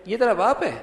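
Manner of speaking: high, incredulous query; denying question. Shown as a demonstration. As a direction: ask it to mean it cannot be so. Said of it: "سوالیہ انداز ہے لیکن انکاریہ ہے".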